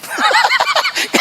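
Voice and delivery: high laugh. Real, not acted. Laughs mid-sentence.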